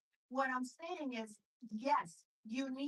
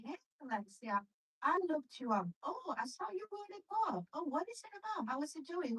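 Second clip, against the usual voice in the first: bright, upbeat, high-pitched small talk; friendly eager greeting; 7 semitones higher than usual. Shown as a demonstration. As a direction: show real interest in her work.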